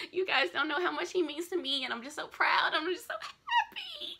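tearful joy. Real, not acted. Emotional fan nearly crying.

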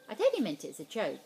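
usual speaking voice

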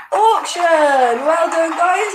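high, cheering announcement; excited reveal. Real, not acted. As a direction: call out the answer in celebration. Revealing answer, congratulating players.

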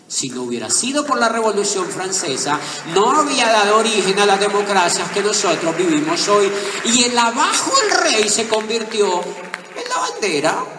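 loud, high rousing speech; impassioned oratory. Acted. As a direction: preach it to the crowd. Orator building to a climax.

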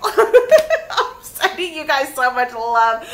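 slow, loud, laughing send-off; laughing farewell. Real, not acted. Reader signing off to viewers, laughing warmly. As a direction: laugh through the goodbye.

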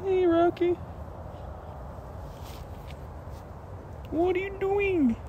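high, sing-song pet talk. Real, not acted. Owner cooing at his pet possum Rocky, baby talk. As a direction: coo up high at the little possum.

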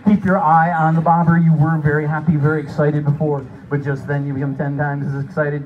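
building to a high, loud climax; excited. Acted. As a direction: whip them into excitement. Excited fishing narration.

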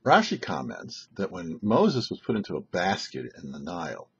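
ordinary talk, nothing marked

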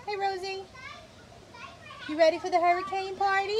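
high, bright sing-song voice for the dog; pet talk. Real, not acted. Owner talks sweetly to dog.

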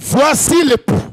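loud, slow proclamation; crying out. Acted. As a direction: call it out to wake the sleeping church. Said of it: "Une voix cria". (cri fort et lent de prédicateur)

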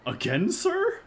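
high surprised clerk; shopkeeper voice. Acted. Voicing shopkeeper.

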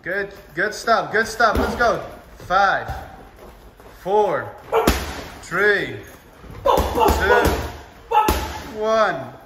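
loud, high coaching yells; shouting. Real, not acted. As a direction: drive them to the bell. Coach pushing final count.

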